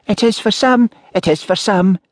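high-pitched village spinster voice; pointed spinster. A narrator's reading. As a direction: needle him in a high, repetitive old-maid voice. Village spinster's pointed, repeated remark.

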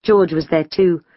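usual speaking voice

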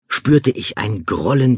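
ordinary delivery, nothing marked

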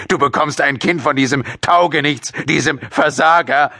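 furious father, pitched high; raging. A narrator's reading. Father raging at daughter about the baby's father, furious rant. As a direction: rant with contempt for the boy. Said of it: "tobte José".

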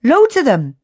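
high, loud exclamations of discovery; excited cry. A narrator's reading. Billy shows friends the star rocks, excited cry. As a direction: call out in amazement. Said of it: "cried Billy".

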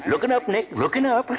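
chuckling through the joke, voice lifted; tickled old man joking; laughing pun. Acted. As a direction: laugh as you toss off the pun. Pop jokes happily.

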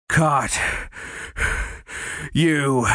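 out of breath from the chase; mild winded pant. Acted. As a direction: gasp the words out between breaths. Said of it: "Derek panted".

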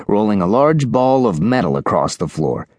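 usual speaking voice

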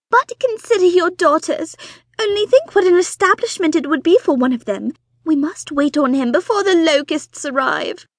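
high, loud, breathless society wife; fussy excited mother. Acted. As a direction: gush it out like an eager matchmaking mother. Mrs Bonnet urging.

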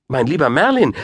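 high-pitched dismay; startled queen. A narrator's reading. Queen protesting the wizard's departure, startled. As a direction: lift the voice high, taken aback. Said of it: "sagte die Königin erschreckt".

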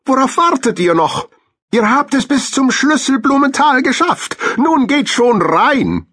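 high-pitched cartoon squirrel voice; high animal voice, urging friends onward; kindly squirrel. A narrator's reading. Squirrel declines and bids hamsters farewell, high animated voice.

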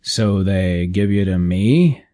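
slow, unsure manager's question; hesitant. Acted. Station manager unsure about the newcomer, slow and hesitant. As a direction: feel your way through the words. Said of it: "He spoke hesitantly".

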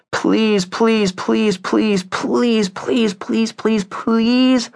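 put-on high-pitched child begging, over and over; pleading. A narrator's reading. Two children begging their mom for the dog, pleading. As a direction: beg in a string of high pleases. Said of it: "pleading with her".

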